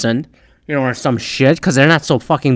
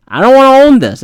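higher and louder, fed up; exasperated outburst; 8 semitones higher, 5 dB louder than usual. Retold or imitated. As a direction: blurt it out in frustration. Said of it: "repeated louder".

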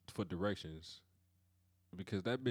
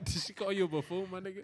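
laughing; 9 semitones higher than usual. Real, not acted. Laughing at Siri's answer.

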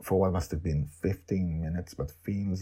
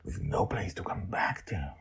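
whisper; 7 dB quieter than usual. Acted. Narrator stresses being lost.

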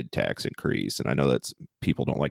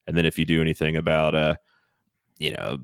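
ordinary talk

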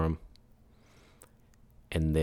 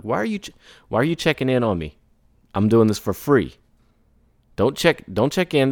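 higher and louder, fed up; mock irritated rant; 5 semitones higher, 4 dB louder than usual. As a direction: snap back at a pushy client. Annoyed rant at client.

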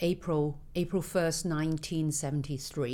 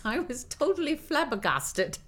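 laughing; 9 semitones higher than usual. Real, not acted. Recalling being mobbed.

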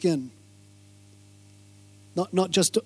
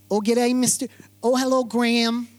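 imitating a girl's strong American accent, a touch brighter; 2 semitones higher than usual. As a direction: greet him in a put-on American accent. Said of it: "Really strong accent".

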